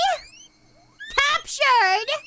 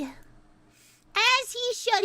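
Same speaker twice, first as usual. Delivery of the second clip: very slow, stretched out; as he should dragged out slowly, every word lingering; slow drawn-out repeat; 4 semitones higher, much slower than usual. Shown as a demonstration.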